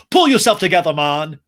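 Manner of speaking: loud, sharp mock telling-off; a loud, hammed-up yell at a crier. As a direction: bark it at him like a fed-up castmate. James snaps at crying Sandoval.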